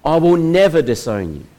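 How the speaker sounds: protesting cry. Acted. Peter protests to Jesus at supper, crying out. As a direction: cry it out as Peter swearing loyalty. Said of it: "And Peter cries".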